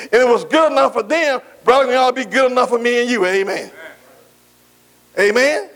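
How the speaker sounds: ordinary talk, nothing marked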